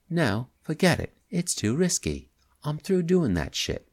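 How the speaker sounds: a touch of working-class English; young man, slight English accent. Acted. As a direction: voice the jittery young crook. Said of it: "slight working-class English accent".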